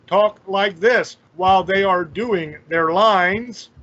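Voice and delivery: slow, stiff over-enunciated delivery; halting mock line-reading; stilted bad acting. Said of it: "why are you talking like that".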